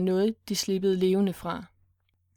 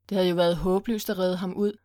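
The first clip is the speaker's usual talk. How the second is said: normal talking voice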